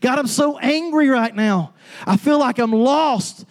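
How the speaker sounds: high, raw cry of a broken heart; David pouring out his heart. Acted. David crying out to God in the Psalms, high anguished plea. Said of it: "David's pouring out his heart to God".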